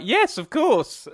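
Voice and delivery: high, loud, drawn-out exclamation; emphatic mock reply. Retelling the joke's reply to being asked about writing it down, mock-emphatic. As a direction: act out an over-eager yes, loud and high. Acting out eager captain's reply in joke.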